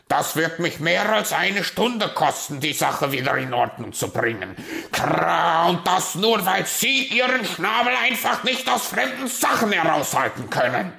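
loud, high rant with a caw; angry, berating raven. Acted. Raven scolding the meddling magpie over damage, angry. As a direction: berate her at full volume, croaking midway.

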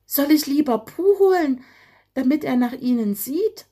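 eager small bird offering to fetch help; worried little bird. A narrator's reading.

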